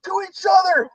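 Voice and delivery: high-pitched mock shriek; hammed-up, high wail of disbelief; mock screaming. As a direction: imitate a horrified roommate screaming. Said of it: "he starts screaming".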